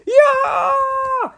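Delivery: very high shout; boy crying out. A narrator's reading. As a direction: yell as the chili hits. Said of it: "he cried".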